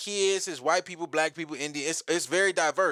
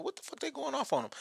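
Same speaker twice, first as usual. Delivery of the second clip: hushed; 8 semitones higher, 8 dB quieter than usual. Retold or imitated. Overhearing a fight.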